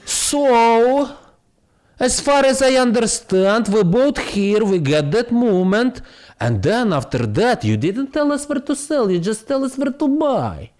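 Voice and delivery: a hint of a high, questioning fan; follower's voice. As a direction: play the eager follower asking, high.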